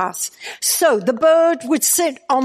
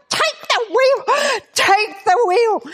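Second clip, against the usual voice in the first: much higher, incredulous echo of the order; stunned disbelief; 9 semitones higher than usual. Retold or imitated. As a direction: repeat it in shocked disbelief. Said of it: "I thought".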